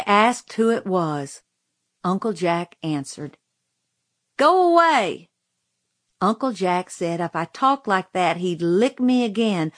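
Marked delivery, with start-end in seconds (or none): 4.3-5.3 loud, high shout of dismissal; angry yell. Acted. Sulking girl to uncle knocking on door, angry yell. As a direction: yell it at the closed door, pitched way up.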